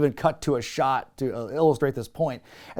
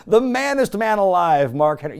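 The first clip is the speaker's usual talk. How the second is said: louder, higher grand intro; mock ring announcer; 5 semitones higher, 6 dB louder than usual. Host mock-introducing Mark Henry, lightly hammed ring announcer.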